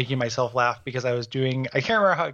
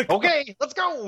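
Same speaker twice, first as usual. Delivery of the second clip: high chipper Muppet voice; 13 semitones higher than usual. Retold or imitated. Cheerful Muppets.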